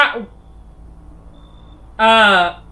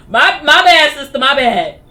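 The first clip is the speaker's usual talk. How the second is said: loud laughing apology; 4 semitones higher, 8 dB louder, slower than usual. Real, not acted. The player loudly apologizes to the old lady, laughing. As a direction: call out sorry, higher and louder.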